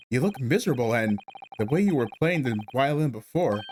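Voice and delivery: normal talking voice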